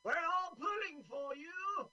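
very high, loud posh English voice; hammed-up jolly old colonel; pompous sputtering colonel. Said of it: "the greatest thing about this movie, is their accents".

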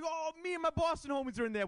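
high, squeaky self-mocking boast; hammed-up bragging in a high voice. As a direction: play yourself as an eager try-hard. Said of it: "I'm like".

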